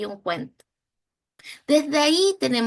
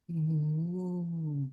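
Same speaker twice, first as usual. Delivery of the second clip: soft low mm for a cow; soft mock moo; 6 semitones lower, 9 dB quieter than usual. Shown as a demonstration. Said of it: "¿Cómo hace la vaca?".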